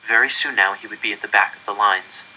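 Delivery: usual speaking voice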